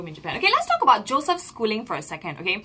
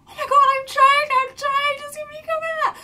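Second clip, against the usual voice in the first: high, loud, flustered protest; mock panicked pleading; 8 dB louder than usual. Kid swimmer pleading with the coach, high and flustered. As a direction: whine that you're trying your hardest. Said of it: "And I was like".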